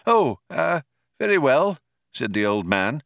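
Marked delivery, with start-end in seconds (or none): 0.1-1.8 put-on high flustered old man voice; old man caught off guard; taken aback. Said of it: "slightly taken aback".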